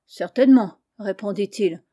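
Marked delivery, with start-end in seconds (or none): 0.1-0.8 louder, ill-humoured reply; grumpy. A narrator's reading. Épiméthée answering Pandore from the corner, grumpy. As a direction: answer with a touch of sulk, forcefully. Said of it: "sans être encore de bonne humeur".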